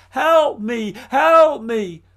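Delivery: high, loud shout for help; a drowning man calling out; the merchant, drowning, shouts for help; cry for help. Acted. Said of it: "Ivan heard a cry".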